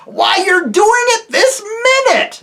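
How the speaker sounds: high, very loud exasperated yell; furious bellow. A narrator's reading. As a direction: bellow the accusation at full force. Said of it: "bellowed Trumpkin".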